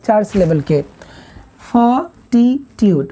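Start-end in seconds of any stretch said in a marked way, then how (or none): 1.7-3.1 "fortitude" slowly in three beats; split syllables. Shown as a demonstration. Teacher counting three syllables, "fortitude" slowly split. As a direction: separate फोर, टि, ट्यूड in "fortitude". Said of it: "one, टू".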